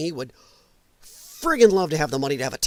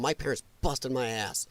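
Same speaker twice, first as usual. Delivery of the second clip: normal talking voice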